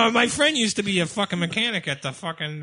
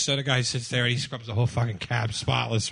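ordinary delivery

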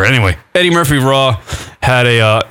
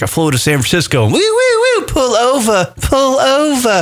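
police siren imitation; 9 semitones higher than usual. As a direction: mimic a cop siren and call. Retelling police stop in comedy bit.

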